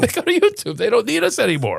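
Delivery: high whiny complaint. Retold or imitated. Whiny mock of kids.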